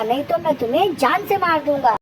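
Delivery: ordinary delivery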